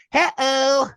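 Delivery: put-on high, loud baby-talk character voice; Lala. Host voicing a Teletubby for sister, high loud baby talk. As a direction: sing out a big toddler-show greeting. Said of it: "And I was like, he says".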